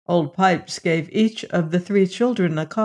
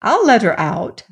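higher, eager resolve; raised, eager voice; exclaiming; 5 semitones higher, 4 dB louder than usual. A narrator's reading. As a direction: declare it with excited determination. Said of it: "he exclaimed".

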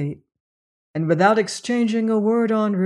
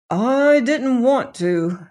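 slow, higher, hesitant; reluctant admission; 6 semitones higher, 4 dB louder, slower than usual. A narrator's reading. Servant admits to the doctor he dreaded going back, slow and reluctant. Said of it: "admitted Crombie".

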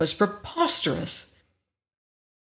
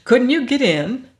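higher, louder relieved questions; relieved questioning; 7 semitones higher, 5 dB louder than usual. A narrator's reading. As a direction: ask urgently, with relief. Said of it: "Pid asked with a sigh of relief".

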